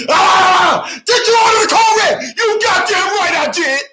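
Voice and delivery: very high, loud, hammed-up screaming match; hot-headed courtroom shouting. Colonel getting hot on the stand, hammed-up angry shout. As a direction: blow up in a full-blown movie-scene shout. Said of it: "Then the colonel gets hot".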